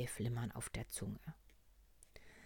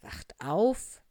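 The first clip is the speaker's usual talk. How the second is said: doctor frog explaining; 3 semitones higher, 7 dB louder than usual. Acted. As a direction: explain it like a bedtime marvel, in short steps. (lebhaft erklärende Arztstimme)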